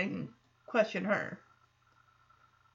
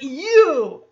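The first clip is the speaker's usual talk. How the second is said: accusing shout; 9 dB louder than usual. Retold or imitated. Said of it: "He's like".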